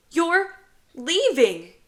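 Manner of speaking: put-on high, loud, drawn-out little boy wail; slow, upset kid's protest; tearful child. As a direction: cry it out between hiccups. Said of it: "James hiccups".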